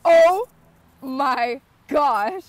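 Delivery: shocked by the picture; real shock. Real, not acted. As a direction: blurt it out in disbelief. Friend reacts to photo.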